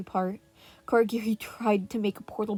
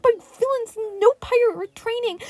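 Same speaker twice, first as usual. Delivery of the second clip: panicked; 12 semitones higher, 10 dB louder than usual. A narrator's reading. Kaminari panicked among villains.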